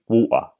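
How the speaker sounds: "water" with no T and no R sounded; dropped-T water. Shown as a demonstration. Host showing the old way of saying it, "water" with dropped T. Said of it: "apparently I say the word water weirdly".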